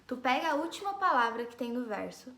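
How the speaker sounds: ordinary talk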